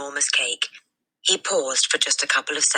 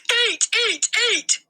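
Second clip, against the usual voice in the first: chanted, much higher shrieking commands; yelling; slower than usual. A narrator's reading. Headmistress yelling at the boy to keep eating, shrill yelling. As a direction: scream it over and over. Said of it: "the Trunchbull was yelling".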